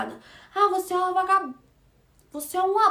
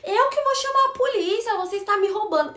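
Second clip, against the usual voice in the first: mock shouting client; 7 semitones higher, 3 dB louder than usual. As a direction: ham up the furious client's yell with a laugh. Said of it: "aí ela começou a gritar". (imitando a cliente gritando, bem mais aguda)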